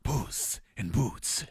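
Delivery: slow, soft whispered impression; Puss in Boots. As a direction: whisper it slowly as a cartoon hero. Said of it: "sounds like more like".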